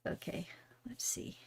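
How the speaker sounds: barely audible whispered aside; hushed thinking aloud; whispering to oneself. Real, not acted. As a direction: whisper it to yourself as you work. Muttering to herself while working.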